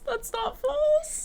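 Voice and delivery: drawn-out high. Real, not acted. Drawn-out repeat of agreement.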